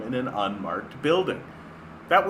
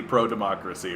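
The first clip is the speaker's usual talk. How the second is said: ordinary talk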